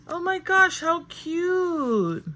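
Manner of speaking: thrilled by a cute little gift, high excited gushing. Real, not acted. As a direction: gush at it, full of delight.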